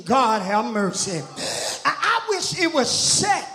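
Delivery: rhythmic preaching with punched, stretched key words; fervent church sermon cadence. Real, not acted. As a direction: preach it hard in bursts to the congregation. Preaches at full force.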